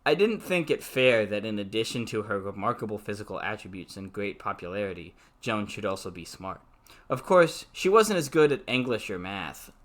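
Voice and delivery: even novel reading voice; story narration. A narrator's reading. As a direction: read the book aloud, steady. Reads novel aloud.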